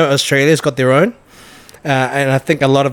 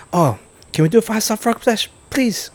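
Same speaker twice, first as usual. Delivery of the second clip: put-on high pleading kid voice; 7 semitones higher than usual. Mates begging as kids.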